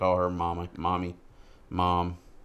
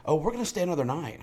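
put-on higher, quick teenage daughter voice; daughter's voice; 9 semitones higher, faster than usual. Dad imitating daughter on the phone, higher and quick. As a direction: toss it off breezily like his teen daughter. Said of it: "She goes".

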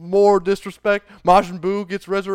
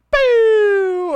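loud, very high blast noise; sustained vocal zap; imitated energy blast; 6 dB louder than usual. Blast hitting a fleeing character, loud imitated zap.

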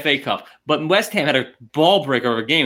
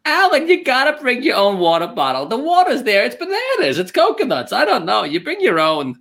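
mock his cheapskate whining; mocking impression; 5 semitones higher than usual. Teasing mock impression of friend.

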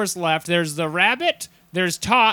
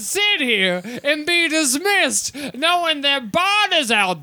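grandstand like a Southern lawyer; 9 semitones higher than usual. Acted. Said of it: "I just started talking like this".

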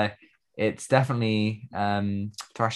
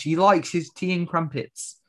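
put-on British accent; 6 semitones higher, slower than usual. Joking about British butler.

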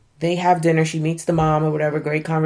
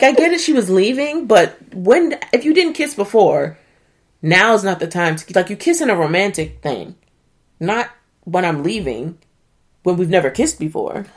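ordinary talk

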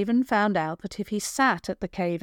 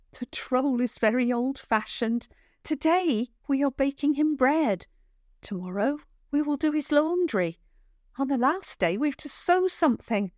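put-on higher girl's voice, brisk and wry; brisk young girl; 6 semitones higher than usual. Kale briefing Javan on the troll's tasks. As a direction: lay it out briskly, eyes rolling.

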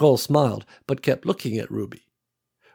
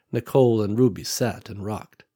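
ordinary delivery

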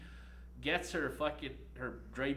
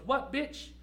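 high, loud outraged comeback; imitating the student's furious retort; angry retort; 11 semitones higher, 5 dB louder than usual. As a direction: snap back at the teacher. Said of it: "She goes".

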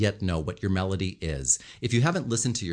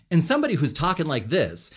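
higher and louder, sure of yourself; confident speaker voice, louder and higher; 6 semitones higher, 4 dB louder than usual. Shown as a demonstration. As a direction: say it like you own the room. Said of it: "sentences are ending on the proper note".

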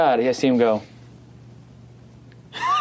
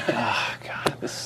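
muttered nervous whisper; 8 dB quieter, much slower than usual. Retold or imitated. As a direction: whisper it to yourself, panicking. Nervous to himself at the mirror.

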